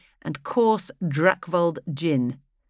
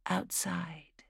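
whispering; 2 semitones higher, 13 dB quieter than usual. A narrator's reading. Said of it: "she had whispered".

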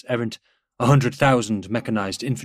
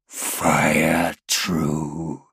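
low, harsh creature voice; monstrous traitor voice; 3 semitones lower, 4 dB louder, much slower than usual. A narrator's reading. Dying traitor Space Marine to the captain, monstrous voice. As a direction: force it out like a wounded monster. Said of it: "the sound of the thing's voice".

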